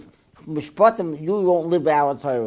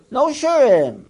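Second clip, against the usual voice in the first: emphatic declaration; 9 semitones higher, 6 dB louder, slower than usual. Acted. Punchline of the ruling.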